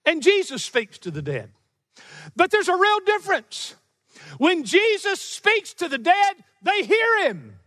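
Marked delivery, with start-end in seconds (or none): 2.4-7.6 higher, emphatic preacher's proclamation; forceful preaching. Real, not acted. Preacher to congregation on Jesus speaking to the dead, forceful. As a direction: drive it home high and deliberate.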